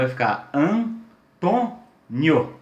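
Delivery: Antônio slowly split into syllables; drawn-out classroom syllabification. Shown as a demonstration. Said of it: "Se eu separar a sílaba".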